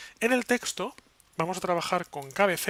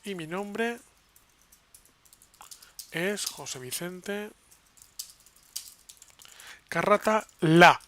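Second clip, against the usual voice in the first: slow, halting, louder on the last words; dictating while typing; 2 semitones higher, 4 dB louder, slower than usual. Real, not acted. Teacher typing own name into the web page, halting dictation. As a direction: spell out the sentence in chunks as you type.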